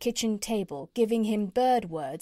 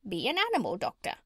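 swooping parrot voice, quick; faster than usual. Acted. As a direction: squawk the advice like a bossy parrot.

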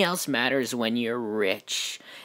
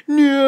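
long, high, loud "no"; mock grief scream; 10 dB louder than usual. Deadpool losing wrestler guy, hammed-up scream. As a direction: wail it out melodramatically.

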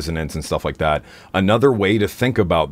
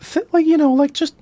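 talking like this. Shown as a demonstration. Demo of a high voice for the teacher's analogy. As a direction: float the voice way up. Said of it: "just talk like this".

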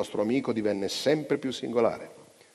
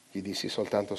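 usual speaking voice

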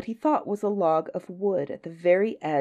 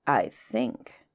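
low crocodile through clenched teeth; between the teeth; 8 semitones lower than usual. A narrator's reading. Crocodile has the child by the nose, talking through teeth. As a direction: say it low, teeth held shut. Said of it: "he said it between his teeth like this".

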